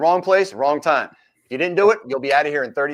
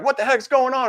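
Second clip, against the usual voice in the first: much higher, panicked question; freaking out; 11 semitones higher than usual. Retold or imitated. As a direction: voice a cuffed suspect's alarm. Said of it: "And they're freaking out".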